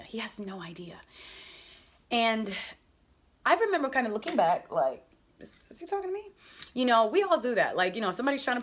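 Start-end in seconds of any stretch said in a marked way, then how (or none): 0.0-1.0 whispered aside, real awe; hushed whisper. Real, not acted. Telling listeners the prophet never knew, whispered. 5.4-6.3 high, unsure inner question; stunned disbelief. Retold or imitated. Retold inner voice as the prophet points at her, amazed.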